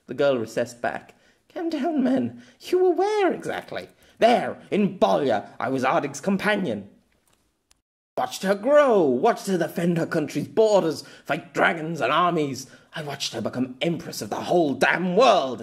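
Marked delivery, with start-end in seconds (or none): 1.5-3.9 put-on much higher, cool young girl's voice; calm him down, a bit superior. Girl telling raging Pierre to calm down, cool. 4.2-15.6 loud, heated telling; impassioned. Acted. Pierre telling the girl his past, heated.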